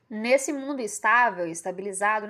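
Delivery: normal talking voice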